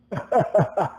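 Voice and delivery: acted-out laugh of the stranger; mocking story laugh. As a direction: laugh right at your opponent, in character. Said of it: "he looks at you and laughs".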